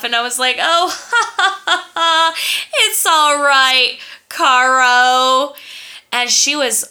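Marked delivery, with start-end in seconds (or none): 0.6-5.5 bright, high forced giggle; fake nice laugh. Brushing off the girl with a fake-nice laugh. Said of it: "My classic fake-ass nice girl laugh".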